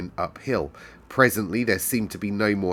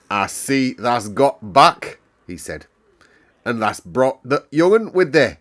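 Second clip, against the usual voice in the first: broad Yorkshire; 3 semitones higher, 7 dB louder than usual. Acted. Station master greets Medlock in broad Yorkshire. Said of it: "pronouncing his words in a strange broad fashion".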